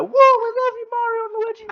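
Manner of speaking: very high, loud mock cheer; cheering crowd. Mock crowd cheering the brothers in a very high voice. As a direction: squeal it like a crowd cheering heroes. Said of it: "like, clapping".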